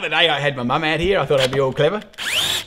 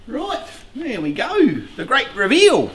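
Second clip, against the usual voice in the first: slow, high-pitched showman's build-up; drawn-out words rising to a big flourish; grand reveal; 7 semitones higher, 4 dB louder, slower than usual. Pretend. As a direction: announce it like an unveiling, ending louder. Unveiling the hive to viewers.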